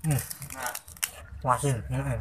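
talking while chewing food; muffled words between bites; mouth full, chewing. Real, not acted. Seen 2: Bites fried mushroom at -1, lips moving closed, chewing while speaking.

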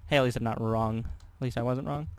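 ordinary talk

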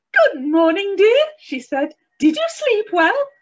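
high, loud, cheerful wife's greeting; chirpy Missus Bear. A narrator's reading. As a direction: sing out a bright good morning to her husband.

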